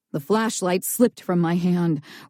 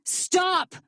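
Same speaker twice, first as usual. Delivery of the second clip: shout; 12 semitones higher, 3 dB quieter than usual. A narrator's reading. Narrator shouting at Lucy.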